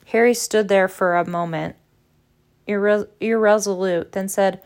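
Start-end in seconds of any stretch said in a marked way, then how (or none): none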